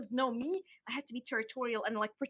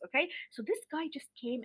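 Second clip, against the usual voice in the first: usual speaking voice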